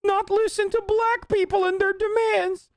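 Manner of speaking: put-on high, halting, stammering kid voice; mock a nervous kid fumbling his words; mocking an anxious kid. Streamer mocks a nervous kid from a debate video, high stammer. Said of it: "where the kid is like".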